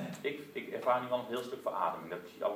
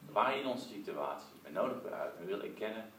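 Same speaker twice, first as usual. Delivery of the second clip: prayer. Acted. Preacher leading the congregation in hushed prayer.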